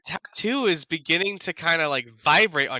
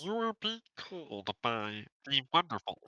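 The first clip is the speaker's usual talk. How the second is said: nerdy voice; 10 dB quieter than usual. Shown as a demonstration. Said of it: "Do, like, a nerdy voice".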